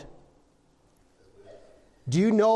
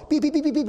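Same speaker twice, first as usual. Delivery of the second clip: beeping tones; 9 semitones higher, faster than usual. Retold or imitated. Imitating touch-tone phone buttons.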